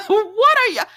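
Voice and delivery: high squealing laugh into the question; a high, real laugh. Real, not acted. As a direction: laugh in disbelief. Laughs at the characters.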